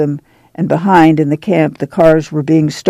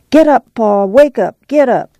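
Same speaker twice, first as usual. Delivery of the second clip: higher-pitched wake-up call; rousing call; 7 semitones higher than usual. A narrator's reading. Ma waking Pa, rousing higher-pitched call. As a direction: rouse a sleeper, pitched up. Ma wakes Pa in story.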